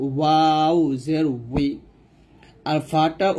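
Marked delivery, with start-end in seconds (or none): 0.1-1.7 উই with lips rounded and a gap between them; rounded-lip demonstration; rounded waw. Shown as a demonstration. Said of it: "ঠোঁটের মাঝখানে ফাঁকা রেখে আমরা ওয়াওটা উচ্চারণ করব".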